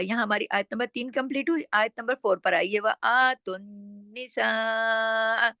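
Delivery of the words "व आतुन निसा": slow व आतुन निसा with long level saa; elongated recitation. Shown as a demonstration. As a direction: hum the n, then hold saa in निसा. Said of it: "फिर सीन के ऊपर मद है". (निसा को मद के साथ खींचकर)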